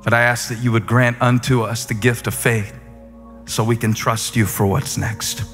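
ordinary talk, nothing marked

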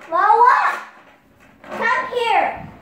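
high, loud summons; calling out. Real, not acted. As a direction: yell for them to come. Summoning someone from another room.

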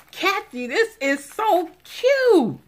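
high, loud, drawn-out squeal of delight; delighted gushing. Real, not acted. Crafter gushing to Kathy over her gift, delighted squeal.

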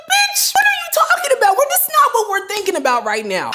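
very high exasperated squeal, coming down at the end; mock irritation. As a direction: exclaim it in hammed-up disbelief. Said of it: "So I'm just irritated".

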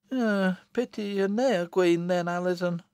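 high country mother, grudgingly softening; wintry amusement. Acted. Mother half-won by daughter's promises, high and wry. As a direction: let a wintry smile into it. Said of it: "relaxing her harsh features into a wintry smile".

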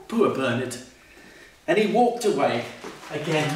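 ordinary delivery

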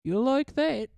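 playful fake British accent. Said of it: "I'm doing British".

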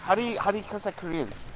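usual speaking voice

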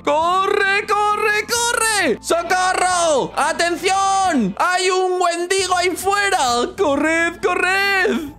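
high panicked yelling; being chased. Real, not acted. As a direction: shout for help while fleeing. Running from the Wendigo, calling.

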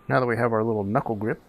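ordinary delivery

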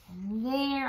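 ordinary delivery

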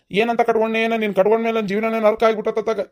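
ordinary delivery

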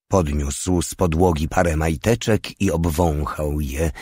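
ordinary delivery, nothing marked

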